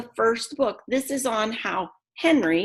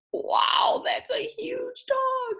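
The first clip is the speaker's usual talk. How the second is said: high, slow amazed wow; much slower than usual. Real, not acted. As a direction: gasp at the size. Reacting to picture.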